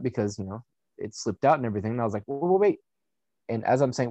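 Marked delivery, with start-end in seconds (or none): 2.3-2.8 higher-pitched retold plea to stop; urgent protest. Man telling partner to stop as she sits, urgent. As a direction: blurt it out as a quick urgent warning.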